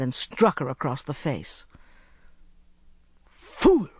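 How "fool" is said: high, loud burst of an insult; explosive, high-pitched angry gasp; gasped outrage. A narrator's reading. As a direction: gasp it out, furious and shocked. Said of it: "he gasped".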